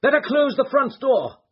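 loud, high urgent call; urgent cry. A narrator's reading. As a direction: call out the order fast. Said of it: "cried Holmes".

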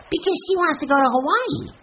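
put-on very high child voice; insistent little boy. A narrator's reading. Benny answers his sister's question, high insistent child voice. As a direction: pipe it up like a small boy explaining.

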